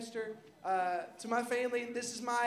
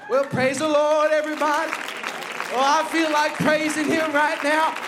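preaching at full force; 6 semitones higher, 10 dB louder than usual. Real, not acted. Pastor urging the congregation to praise, loud preaching. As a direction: rouse the church to praise.

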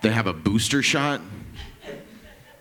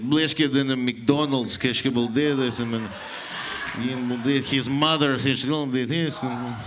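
mock Russian gibberish; 4 dB quieter, slower than usual. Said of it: "He's like".

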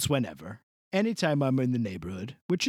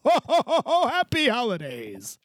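Santa ho ho ho; 8 semitones higher than usual. Acted. Santa sign-off laugh.

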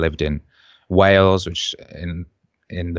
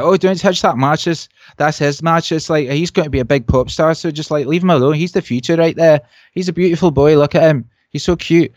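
high, excitable Scottish junkie impression; put-on Scottish accent, pitched well up; 8 semitones higher, 4 dB louder than usual. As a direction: imitate doting Scottish squatters. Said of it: "having to pretend to be Scottish".

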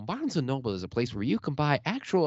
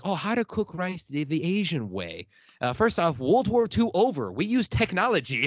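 American voice; 6 semitones higher, 4 dB louder than usual. Retold or imitated. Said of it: "I'll say it in an American voice".

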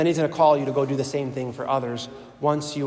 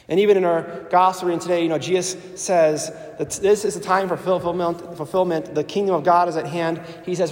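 ordinary talk, nothing marked

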